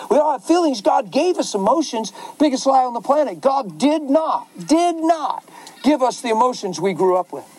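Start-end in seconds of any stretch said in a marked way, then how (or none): none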